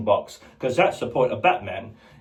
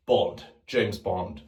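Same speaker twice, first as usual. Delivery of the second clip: James Bond; 5 semitones lower, 4 dB quieter, slower than usual. Retold or imitated. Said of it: "the different James Bonds".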